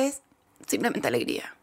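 low, soft, deflated delivery; very sad. Real, not acted. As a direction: let the joy sink into sadness. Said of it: "lo hiciste súper triste igual".

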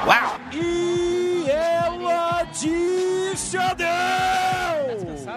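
long drawn-out high shout; home run call. Acted. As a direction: stretch the call out like a stadium roar. Radio home run call clip. (grito arrastado de home run)

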